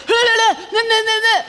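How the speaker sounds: a high, loud, hammed-up gibberish yell; mock foreign yelling. Sailor on the gangplank yells at her, mock foreign gibberish. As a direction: mimic a sailor shouting in a language you don't speak. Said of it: "yelling at me in, like, Mallorquin".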